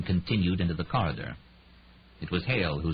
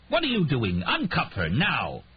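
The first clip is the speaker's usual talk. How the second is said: enraged; 8 semitones higher than usual. A narrator's reading.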